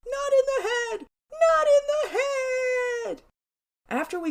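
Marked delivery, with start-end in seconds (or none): 0.1-1.1 put-on high, loud kid's yell; shrill-pitched mock yell of a drilled victim; yelled protest. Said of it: "while he yelled". 1.3-3.2 very high, loud, slow wail; long high-pitched cry of a tormented brother; drawn-out yelled protest. Retold or imitated. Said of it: "while he yelled".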